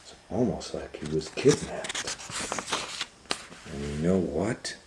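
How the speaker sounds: ordinary delivery, nothing marked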